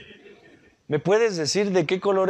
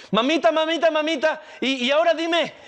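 put-on eager child; 8 semitones higher than usual. Eager child asks mother.

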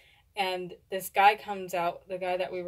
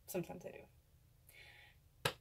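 slow whispered admission; whispered afterthought; 17 dB quieter, much slower than usual. Real, not acted. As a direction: breathe it slowly to yourself. Muttered afterthought.

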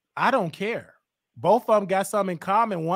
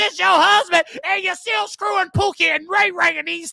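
high-pitched shouted tirade; heated shouting; 7 semitones higher, 4 dB louder than usual. Real, not acted. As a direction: shout the accusation at the caller. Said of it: "shouting, that fake shouting you be doing".

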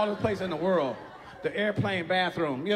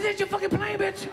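indignant snap; 10 semitones higher than usual. Retold or imitated. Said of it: "I said".

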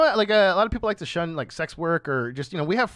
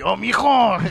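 hammed-up Mexican accent, louder and higher; 6 semitones higher, 8 dB louder, slower than usual. Shown as a demonstration. Obliging a request for an accent, hammed-up Mexican accent. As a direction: lay on a loud Mexican accent. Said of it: "does a Mexican accent sound like".

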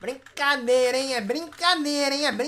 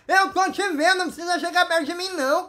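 louder, higher, quick scolding; annoyed scolding; 6 semitones higher, 4 dB louder, faster than usual. Real, not acted. Streamer telling a player to keep away, scolding. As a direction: snap at a player to back off.

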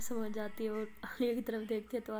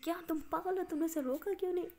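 higher, sharp disbelief; exasperated scolding; 6 semitones higher, 3 dB louder, faster than usual. Acted. Rimsha scolding Aliya for not stopping Amaya, exasperated. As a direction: scold her for letting him go.

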